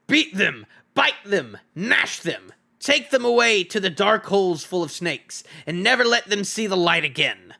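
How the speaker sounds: high, loud goblin commands barked in a rage; goblin shouting orders. A narrator's reading. Great goblin ordering his soldiers to drag the prisoners off, raging loud. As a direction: order the guards about like a furious goblin king. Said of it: "the great goblin shouted".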